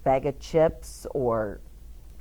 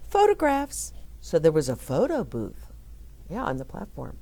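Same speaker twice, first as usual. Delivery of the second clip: usual speaking voice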